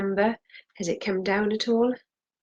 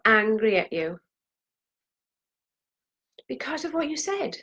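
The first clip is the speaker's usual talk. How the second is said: venting anger while tapping; building anger; 3 semitones higher, 3 dB louder than usual. Acted. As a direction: pour the pent-up anger out, rising. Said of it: "feel free to put as much emotion".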